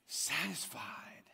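slow, breathed-out whisper. Acted. Preacher breathing out justice satisfied, whispered. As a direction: let the word sigh out. Quiet contrast after loud climax.

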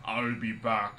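low, throaty action-hero impression; deep Austrian-accented tough guy; Arnold Schwarzenegger. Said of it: "the Arnold Schwarzenegger kind of".

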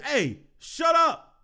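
mock-stern shut-down, drawn out; retold angry rebuke. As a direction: imitate an umpire silencing a heckler. Umpire snaps back at a fan.

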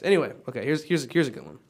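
ordinary delivery

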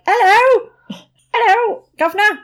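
high, loud, cartoonish posh English greeting; posh old film Brit. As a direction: ham up a stuffy old-film gentleman calling out. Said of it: "the people in it are proper like".

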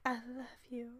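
half-hearted muttered confession; under his breath. Pretend. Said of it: "under his breath or something stupid".

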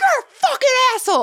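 high, fast shouted insult; yelling back. Retold or imitated. Shouting back at the rude driver, angry yell. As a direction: hurl it back at him at the top of the voice. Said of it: "just yelling".

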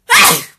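loud burst of a sneeze with a cry. Real, not acted. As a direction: sneeze out hard with an ai. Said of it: "vou espirrar".

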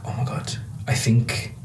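imitating a terrified colleague's whisper. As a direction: whisper it like you're frightened. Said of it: "she looked, like, terrified".

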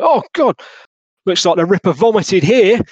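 high, loud cry of dismay; appalled police officer. Acted. Officer recoiling at the Ripper scene, loudly appalled. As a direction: exclaim it like a disgusted copper.

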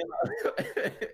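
high breathy burst of real laughter; laughing. Real, not acted. Laughing at loading into a load screen, bursting into real laughter. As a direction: crack up at the joke.

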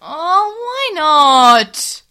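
high, loud wail of dismay; dismayed outcry. Acted. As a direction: cry it out in disbelief. Character's dismayed outburst.

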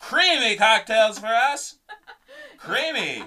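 put-on high and loud, laughing through a snooty accent; hammed-up old-money accent, giggling; mock posh accent. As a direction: savor "Creamy" like a society hostess. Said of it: "that was a good accent".

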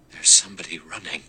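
frightened, whispered warning; scared whisper. Acted. Rogers stopping with the plates, a scared whisper. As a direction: hush them with sudden fear. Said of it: "in an odd, scared voice".

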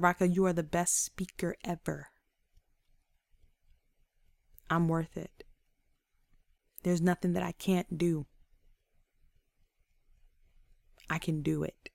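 low, soft, earnest words to oneself; quiet self-affirmation. Shown as a demonstration. Speaker telling herself she is worthy, quiet affirmation.

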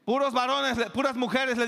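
loud, high shouted orders; calling out. Retold or imitated. Crew shouting orders at the lifeboats, loud. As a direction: shout across the deck.

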